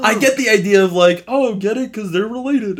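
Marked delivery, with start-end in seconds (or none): 1.3-2.8 high-pitched mock explanation of a gag; mocking imitation. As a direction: pitch it up, making fun of the idea. Mocks the filmmakers' casting idea.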